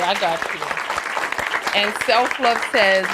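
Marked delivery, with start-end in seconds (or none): 0.0-1.8 reassuring. Real, not acted. Reassuring a viewer named Kay.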